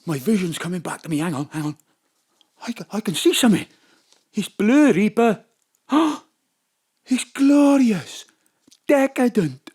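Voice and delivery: low, breathless wonder; awed John. Acted. John's sight returns, low and breathless.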